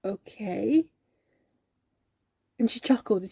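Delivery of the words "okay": hesitant okay with a questioning lift; bemused, bewildered. Acted. Answering colleague's talk of Christ's birth, bewildered. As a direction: draw it out, unsure what to make of it. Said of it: "bemused and bewildered".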